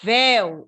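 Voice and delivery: "Véu" hit loudly as a strong syllable; strong stress. Shown as a demonstration. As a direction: put full weight on "Véu". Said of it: "véu é forte". ("Véu" forte, bem tônico)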